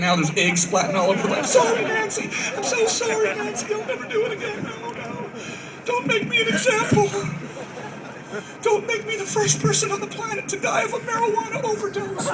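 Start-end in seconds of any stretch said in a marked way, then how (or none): 1.5-12.3 high, whimpering begging voice; panicked pleading. Retold or imitated. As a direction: plead for your life, terrified. Begging Nancy Reagan while high.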